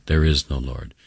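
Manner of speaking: ordinary delivery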